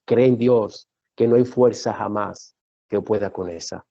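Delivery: deep, low, weighty preacher; solemn preaching. Real, not acted. Preacher telling the church nothing compares to God, solemn. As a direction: declare it gravely, pitched far down.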